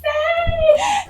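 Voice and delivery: high crying shriek; wailing cry. Retold or imitated. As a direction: break into a crying wail. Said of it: "Chorando horrores".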